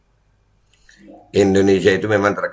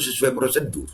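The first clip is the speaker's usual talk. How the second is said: sarcastic quote; 5 semitones higher, 5 dB quieter than usual. Retold or imitated. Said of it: "kata Pak Niko".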